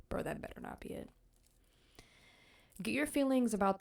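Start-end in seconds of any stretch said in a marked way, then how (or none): 0.1-1.1 fast, voiceless mutter; muttered dread. Real, not acted. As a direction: plead under your breath.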